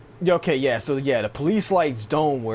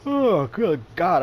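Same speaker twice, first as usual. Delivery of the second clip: yawning; 6 semitones higher, 5 dB louder, slower than usual. Real, not acted. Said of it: "I am so sorry that I'm yawning".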